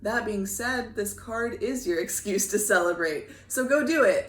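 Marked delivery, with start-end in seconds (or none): 3.5-4.3 excited. Real, not acted. Cheering viewer on to celebrate.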